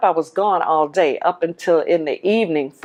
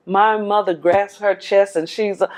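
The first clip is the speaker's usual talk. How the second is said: ordinary talk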